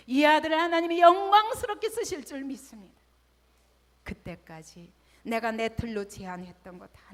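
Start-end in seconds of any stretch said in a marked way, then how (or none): 0.1-3.0 fervent prayer. Retold or imitated. Prayer rising to proclamation.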